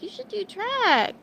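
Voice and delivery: high, loud and slow mock coach push; coaches urging. Streamer imitating coaches who told her to do track, loud, high and slow. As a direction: mimic the coaches telling her to join the team. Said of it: "they were like".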